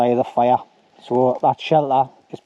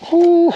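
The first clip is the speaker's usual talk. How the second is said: a loud high whew of relief, blowing out smoke; 7 dB louder than usual. Real, not acted. Coughing in the smoke, he lets out a loud high whew. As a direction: blow out a big high whew after the smoke.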